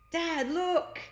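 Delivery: high excited call; calling dad. Acted. Kids calling to father.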